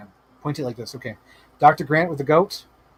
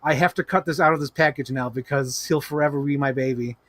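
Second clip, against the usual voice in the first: normal talking voice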